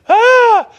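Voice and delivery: short high mock shriek; imitated frightened shriek. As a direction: let out a sudden loud, high yelp. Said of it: "They shrieked".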